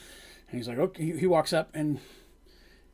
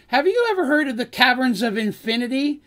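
eager question; 8 semitones higher, 5 dB louder than usual. Acted. Dandy asks the loaded question.

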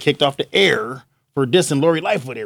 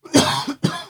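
high strained cough; coughing fit; 13 semitones higher than usual. Real, not acted. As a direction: choke and cough. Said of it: "I'm choking".

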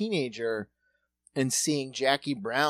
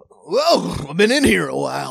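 louder, dazed character stepping out; a lightly voiced surprised man, louder; Keaton cameo; 10 dB louder than usual. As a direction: call it out like someone stuck inside for ages. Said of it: "he's like".

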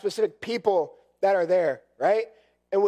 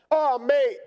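higher-pitched, clumsy attempt at a British accent; a failed stab at a British accent, half laughing at yourself; amateur British accent, higher voice; bad British accent; 6 semitones higher than usual. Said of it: "I can't do the British accent".

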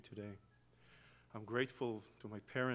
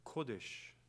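ordinary delivery

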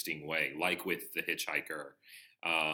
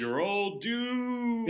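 creepy doomsayer voice; 6 dB louder, much slower than usual. Retold or imitated. Imitating Crazy Ralph's warning.

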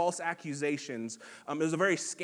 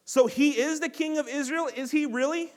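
scoffing; 7 semitones higher, 5 dB louder than usual. A narrator's reading. Priests scoffing at Jesus on the cross, high mocking sneer. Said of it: "they scoffed".

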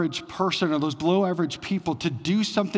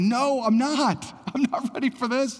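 mock panic; 8 semitones higher than usual. Pastor voices terrified shepherds facing God, mock panic. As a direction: play the frightened shepherd, half laughing. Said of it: "Their response would be".

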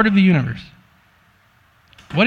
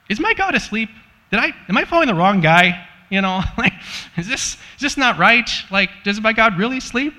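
worried disciple; 7 semitones higher than usual. Retold or imitated. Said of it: "if I'm a disciple".

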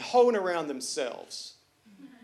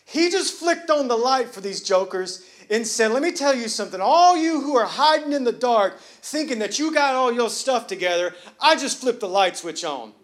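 full-force preaching; 5 semitones higher, 7 dB louder than usual. Acted. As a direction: preach it, building to the crowd's amens.

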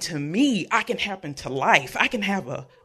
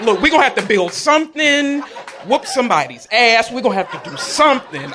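fired-up rallying; 4 semitones higher, 5 dB louder, faster than usual. Retold or imitated. Rallying best friend, retold.

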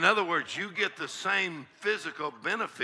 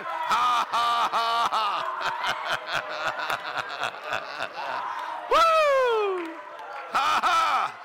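holy laughter; slower than usual. Real, not acted. Preacher breaking into holy laughter before the church. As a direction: laugh freely, whoop and roll. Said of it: "laughing in faith".

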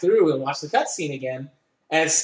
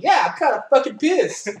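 higher, emphatic annoyed admission; mock pissed; 6 semitones higher than usual. Player admitting to Alice he is angry, mock annoyed. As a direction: own the anger with a grin.

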